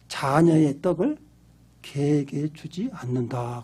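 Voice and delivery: slow, low, measured pronouncement; stern retold refusal. Jesus turning to refuse the foreign woman, slow low voice. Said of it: "그 여인을 딱 돌아보면서 뭐라고 하니".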